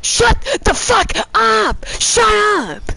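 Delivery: high, slow furious shout; angry shout. Real, not acted. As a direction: bark it at the singer. Yelling at singing teammate.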